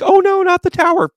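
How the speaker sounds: high, loud hammed-up panic; mock dismay. Everybody dreading the tower card, mock-panicked wail. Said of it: "everybody's like".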